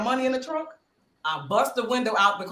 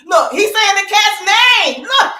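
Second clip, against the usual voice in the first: excited exclamation; 11 semitones higher, 10 dB louder than usual. Real, not acted. Telling friends about the cat, loud high excitement. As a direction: shout it out laughing.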